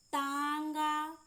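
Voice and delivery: तांगा slowly, first aa held long; drawn-out nasal aa. Shown as a demonstration. Teacher modelling for learners, long nasal aa in तांगा. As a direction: stretch the nasal vowel of तांगा. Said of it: "ज़्यादा इसको खींचते हैं".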